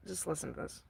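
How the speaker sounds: normal talking voice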